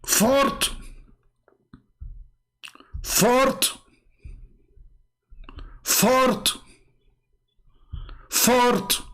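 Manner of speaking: high, drawn-out mock "Fart" with a rising lilt; mocking repetition. Mocking opponents by repeating the word, high and drawn out. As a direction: savour each "Fart" like a ridiculous find.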